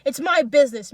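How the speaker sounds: loud, high defiant shout; forceful possessive yell; angry yell. A narrator's reading. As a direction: bellow it to shut the crowd up. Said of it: "Misha yells".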